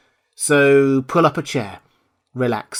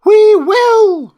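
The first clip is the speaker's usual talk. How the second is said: very high, loud squeaky cry; shrill chorus; 12 dB louder than usual. Acted. Mice promise their Queen obedience, shrill chorus. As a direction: shout it as a crowd of mice. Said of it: "cried all the mice in a shrill chorus".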